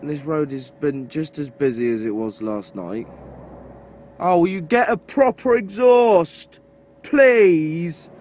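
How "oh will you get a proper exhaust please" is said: raised, pleading voice at the noisy car; loud fed-up begging, pitch up; exasperated shout at a noisy car; exasperated plea. Real, not acted. Yelling at loud exhaust.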